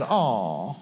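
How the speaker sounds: low, softened pitying coo; mock sympathy. Jezebel comforting pouting Ahab, mock sympathy. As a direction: draw it out low, as if consoling a pouter. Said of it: "Jezebel came and said".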